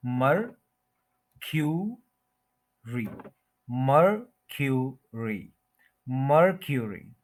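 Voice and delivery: मरक्यूरी as slow "MUR... kyu... ree", joining up gradually; syllable-by-syllable pronunciation. Shown as a demonstration. Teacher breaking the word down, slow careful pronunciation. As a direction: break मरक्यूरी into three beats with a "kyu" middle. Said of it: "इसको क्यू बोलेंगे".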